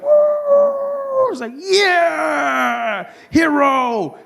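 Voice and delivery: high, drawn-out mock cheering; triumphant whoop. Driver after a lucky swerve, high triumphant cheer. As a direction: celebrate like a self-made hero.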